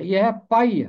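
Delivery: ordinary delivery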